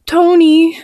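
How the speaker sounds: high, drawn cry after the cat; bawling. Acted. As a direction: call out high in distress. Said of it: "I bawled".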